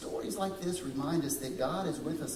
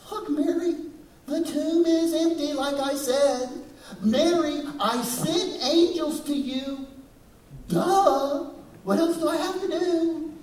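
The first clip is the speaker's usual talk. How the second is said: much higher and louder, fed-up scolding; impatient exasperated outburst; 12 semitones higher, 8 dB louder than usual. Pretend. Preacher imagines snapping at Mary, loud and exasperated. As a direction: throw up your hands at Mary, exasperated. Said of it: "I might have said".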